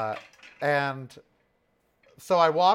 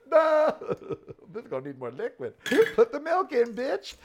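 laughing at self; 11 semitones higher than usual. Real, not acted. Laughing at own mistake.

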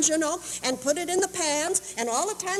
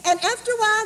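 normal talking voice

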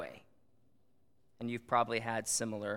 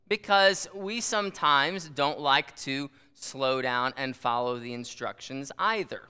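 ordinary delivery

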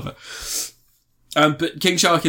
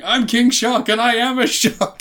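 high, slow hammed-up monster boast; higher, slower creature boast; King Shark line; 11 semitones higher, 3 dB louder, slower than usual. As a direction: boom it out like a proud dumb shark. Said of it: "And he's just going".

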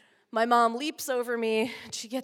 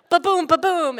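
loud imitated thuds, higher pitch; bumping sound; 8 semitones higher, 10 dB louder than usual. Kid bouncing over bumps, imitating thuds loudly. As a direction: mimic each bump of the street. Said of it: "feel like".

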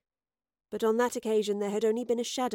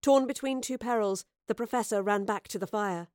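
plain reading voice

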